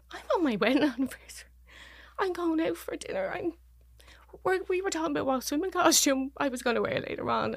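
high-pitched, distraught disbelief; retold reeling, voice pushed high and unsteady; stunned protest. Retells her disbelief at the news.

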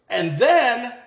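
normal talking voice

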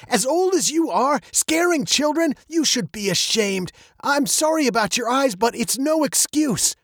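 high-pitched reproach, only mildly loud; scolding father. A narrator's reading. Father rebukes old man for scaring children, scolding. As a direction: shame him for frightening a child. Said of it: "he said loudly".

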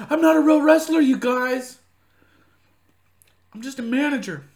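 high, loud goofy wrestler voice; mock wrestler voice. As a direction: play the sheepish wrestler. Voicing the wrestler.

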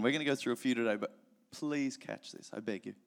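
ordinary delivery

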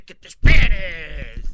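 loud, drawn-out "wait!"; exasperated scolding. Retold or imitated. Priest to crying baby at baptism, exasperated yell. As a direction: yell it out slowly at a squirming baby.

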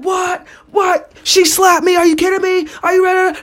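high-pitched mock disbelief; shocked. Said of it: "shocked as ever".

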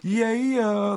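high, head-tilted yeah yeah; Mordecai's yeah yeah. Retold or imitated. Imitating Mordecai's catchphrase, high and tossed off. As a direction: toss the head back and sing it out. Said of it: "quote type moniker".

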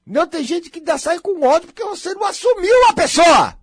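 shouted high and loud; indignant shout. Real, not acted. Speaker railing against people who resent refusal, shouting. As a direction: let the indignation burst out.

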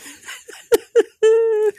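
a loud, high shriek of laughter. Real, not acted. Cracking herself up on camera, high shrieking laugh. Laughing at her own punchline.

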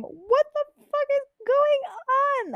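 very high, drawn-out mock scream; retold scream. Student mutes her class to react, high retold scream. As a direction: act out a slow, squealed freak-out. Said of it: "mute it, and scream".

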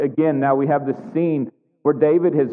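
normal talking voice

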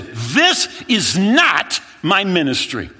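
high, loud, slow frantic shout; panicked demand. Retold or imitated. To his friend in the hot village, a slow frantic shout. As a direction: demand an answer, losing it.

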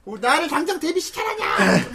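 put-on high cat-girl protest cry; cutesy nya speak. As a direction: demand it like a pouting cat girl. Catgirl idol protesting at company. (높은 고양이 말투로 외치기)

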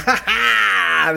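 high mock cackle; big put-on "ha ha" laugh; imitated group laughter. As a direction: mimic passengers bursting out laughing. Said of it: "they laugh".

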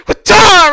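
loud, high-pitched mock stab sound; imitated stabbing noise. Acting out the stab in the neck, loud imitated noise. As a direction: burst out with an explosive stab effect.

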